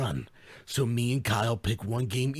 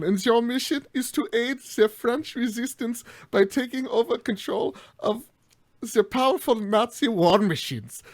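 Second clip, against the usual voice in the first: higher, louder mock German accent all through; hammed-up German accent; 8 semitones higher, 7 dB louder than usual. Host pitching the game in a hammed-up German accent. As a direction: play up a cartoon German accent. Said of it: "we're gonna do the accent".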